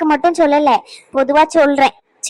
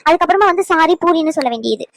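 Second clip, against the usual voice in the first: ordinary talk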